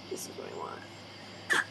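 soft, slow whisper. Real, not acted. Handing something over and murmuring to herself, a slow whisper. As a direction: whisper it to yourself, slowly.

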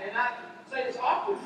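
normal talking voice

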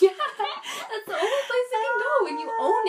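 laughing through the words, pitch jumping high. Real, not acted. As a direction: speak while breaking into laughter. Laughing while finishing a thought.

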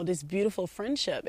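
normal talking voice

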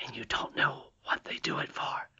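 whispered, wary question; soft whispering princess; hushed whisper. Acted. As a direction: ask it under your breath. Whispered question about nearby goblins.

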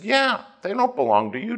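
high, bright little receptionist voice; chirpy receptionist. Retold or imitated. Receptionist teasing her boss, high mock voice. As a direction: butt in with a cheeky dig.

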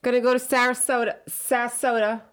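Rhode Island retiree. Retold or imitated. Retirees bragging about moving away, Rhode Island voice. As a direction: play a local retiree. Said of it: "they're always like".

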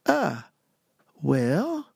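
high, drawn-out and halting; hesitant agreement. Retold or imitated. Young applicant accepting a job by phone, hesitant drawl. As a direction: stretch the words out as you stall.

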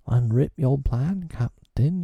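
high, keen and eager; eager cry. A narrator's reading. Smee eagerly begging Hook for his plan. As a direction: lean in, hungry for the plan. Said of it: "he cried eagerly".

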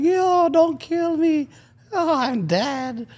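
very high, slow, wailing plea; drawn-out high ghost whimper; ghostly Toronto panic. Retold or imitated. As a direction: wail it like a scared ghost. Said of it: "start reliving their trauma".

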